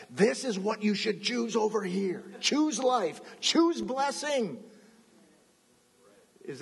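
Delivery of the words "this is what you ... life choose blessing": higher, earnest urging, slow at the end; God urges his people, higher and pleading; God advising. Acted. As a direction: point the way with eager insistence. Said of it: "He says".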